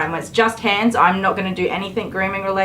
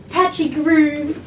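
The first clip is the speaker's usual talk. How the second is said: high teasing; 8 semitones higher, slower than usual. Real, not acted. Teasing the dog.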